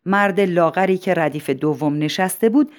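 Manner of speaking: usual speaking voice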